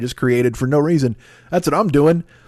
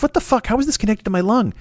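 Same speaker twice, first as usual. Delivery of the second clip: high-pitched mock shock; a yelp of horrified surprise; 8 semitones higher than usual. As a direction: squeal it in disbelief. Acted shock at lung pulled out.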